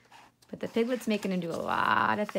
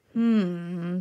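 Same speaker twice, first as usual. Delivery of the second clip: thoughtful hmm; 3 semitones lower, 5 dB louder than usual. A narrator's reading. Fox ponders.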